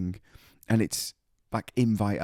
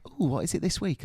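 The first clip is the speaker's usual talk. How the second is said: lightly put-on higher northern English voice; mock northern English mum, pitched up; 6 semitones higher than usual. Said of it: "my mum is now really northern".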